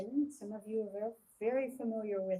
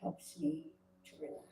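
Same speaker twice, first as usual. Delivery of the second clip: guided meditation. Acted. Leader talking listeners through a calming visualisation, guided meditation. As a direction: guide listeners into calm, phrase by measured phrase.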